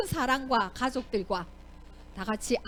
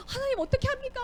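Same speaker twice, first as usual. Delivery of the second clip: much higher, pleading wail; desperate cry to God; 11 semitones higher than usual. Acted. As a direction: cry out in despair. 여호수아의 울부짖는 기도. (하나님께 울부짖는 여호수아, 절박하게)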